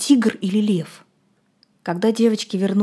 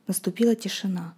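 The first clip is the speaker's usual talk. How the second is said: ordinary delivery, nothing marked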